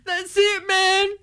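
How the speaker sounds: panicked marine, high and drawn out; high, slow, laughing space-marine despair; Bill Paxton in Aliens. Acted. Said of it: "That was not in the script".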